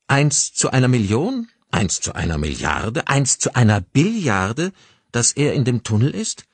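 escalating disbelief, each question ending on a rise; incredulous questioning. Acted. Detective to his colleague about the tunnel search, incredulous questioning. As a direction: doubt the odds, stretching the last one out. Sceptical colleague mocks the odds.

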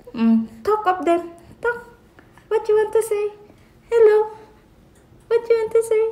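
high sing-song coo to an infant. Real, not acted. Mother asks her baby what he wants to say, in a high coo.

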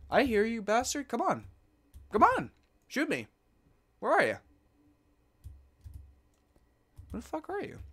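high, loud taunting calls; frustrated yelling at an unseen foe; yelling taunts. Real, not acted. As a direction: call out to provoke the enemy. Yelling at hidden skeleton.

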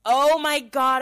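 loud, high mock fury; retold outburst of annoyance; exasperated outburst. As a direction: burst out, totally fed up. Retold fury at repeated snoring.

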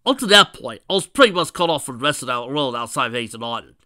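loud, indignant growled retort; defensive growl. Acted. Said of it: "Knuckles growled".

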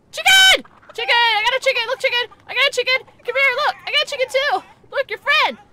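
high, loud delighted shouts; excited yelling. Real, not acted. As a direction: call the chickens over, thrilled.

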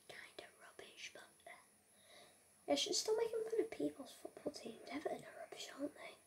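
hushed, breathy aside as if not meant to be heard; cheeky whisper. Acted. Gamer whispers a rude aside about Leeds and Everton, cheeky whisper. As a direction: whisper the insult behind your hand.

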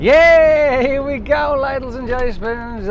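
loud, high hyped-up host call; excited announcer. Pretend. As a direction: whip up the crowd.

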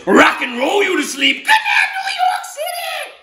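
very high, loud rock shout; rock star octopus. Acted. Octopus rocking the boy to sleep, loud and high. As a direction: scream it like a rock concert. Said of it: "the octopus says".

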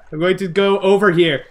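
ordinary talk, nothing marked

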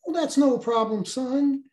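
a taunting officer offering a way out; mock-friendly officer. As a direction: sneer it out with false kindness. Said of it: "in typical fashion".

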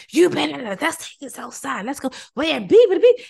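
hammed-up confrontation, itching to fight; mock fight-picking. Someone who wronged you gets challenged outside, hammed-up furious yelling. Acted fight, what not to do.

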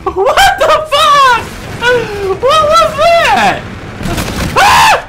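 very high, slow, shouted disbelief; shrieking disbelief. Real, not acted. Gamer to teammates after a blast, high shocked shriek. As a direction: screech it like you can't believe your eyes.

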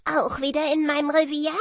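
loud, high, quick call; shouted question. A narrator's reading. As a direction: call out loudly to a returning neighbour. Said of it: "schrie Harald". (laut und hoch gerufene Frage)

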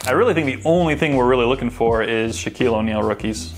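ordinary talk

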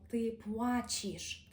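«ти плачеш» said slowly with a soft ч; teacher shows the soft ч slowly; soft Polish sounds. Shown as a demonstration. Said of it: "і тут же м'якіше звук «ч»".

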